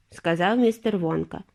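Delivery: ordinary delivery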